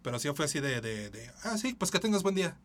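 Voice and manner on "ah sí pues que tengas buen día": fake polite. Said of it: "Es como, "Ya, vete a chingar".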